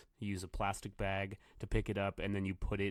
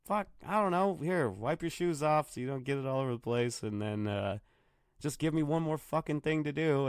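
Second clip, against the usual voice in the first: angry; 5 semitones higher, 3 dB louder than usual. Retold or imitated. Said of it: "And he's like".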